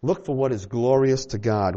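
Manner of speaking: ordinary delivery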